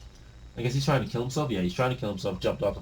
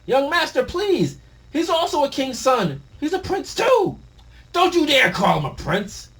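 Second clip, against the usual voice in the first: much higher, loud begging voice; pleading servant; 5 dB louder than usual. Acted. Servant begging Loki to stop, desperate plea.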